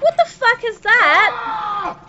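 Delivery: high, loud, slow laughing disbelief; startled laugh at the screen; laughing shock. Real, not acted. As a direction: blurt it out between laughs. Sees something startling in game.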